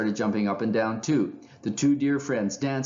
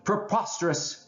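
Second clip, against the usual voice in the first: mock shout; 11 semitones higher than usual. A narrator's reading. Someone in the crowd objecting, high mock shout. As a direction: exclaim it high, as a contained shout. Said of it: "someone shouted out".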